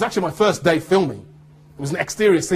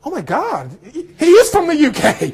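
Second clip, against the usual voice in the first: high, rising to loud, imitating her amazement; mock astonishment; 11 semitones higher, 6 dB louder than usual. Server discovers he is British, retold high astonishment. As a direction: exclaim it high, building to a loud mock announcement.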